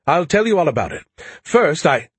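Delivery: high, loud eager rush; excited chatter. A narrator's reading. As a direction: burst out eagerly. Skippy excited, talking loudly.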